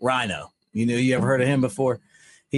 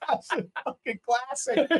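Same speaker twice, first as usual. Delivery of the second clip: high, soft laugh-through; words squeezed out while laughing; 4 dB quieter than usual. Real, not acted. Teller cracking up over the Rhino story.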